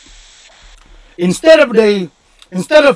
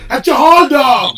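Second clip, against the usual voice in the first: loud demo; 4 semitones higher, 5 dB louder than usual. Shown as a demonstration. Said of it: "never call them dog".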